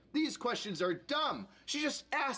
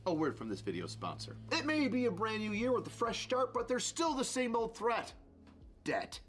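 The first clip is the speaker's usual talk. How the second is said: steady, lower ad-read voice; sponsor ad read; 5 semitones lower than usual. Acted. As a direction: sell it like a radio spot.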